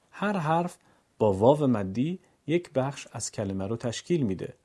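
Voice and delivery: usual speaking voice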